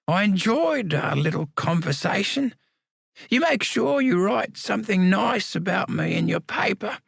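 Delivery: put-on wry old convict, stretching out still alive; frail old man. Samuel's farewell.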